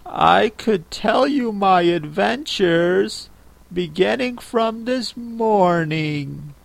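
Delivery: high, slow, soft and hesitant; timid Alice. Acted. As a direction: venture it shyly and slowly. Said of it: "said Alice a little timidly".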